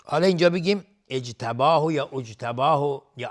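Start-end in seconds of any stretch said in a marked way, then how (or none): 1.1-1.9 "اجتباه" quickly as ijtabahu, louder; candidate starting vowel i. Shown as a demonstration. Teacher lists possible starting vowels, "اجتباه" as ijtabahu. 2.1-3.0 "اجتباه" loudly as ujtabahu; wrong starting vowel u. Shown as a demonstration. Teacher lists possible starting vowels, "اجتباه" wrongly as ujtabahu.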